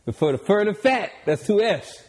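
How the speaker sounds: high, loud boastful hype; hyped bragging. Real, not acted. Bragging to the host about his playing days, hyped and high. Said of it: "hyped boasting about hoop days".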